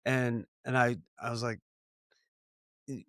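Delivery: normal talking voice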